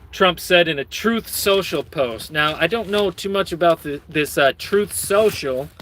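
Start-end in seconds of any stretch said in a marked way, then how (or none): none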